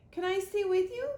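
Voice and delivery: high, loud pleading gosling; gosling begs his robot mother, high and urgent. Acted. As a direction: beg to stay like a little bird.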